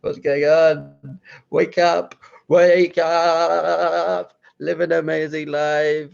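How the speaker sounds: slow, loud urging shout; rousing call. Pretend. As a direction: rouse yourself like a drill coach. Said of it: "It's like".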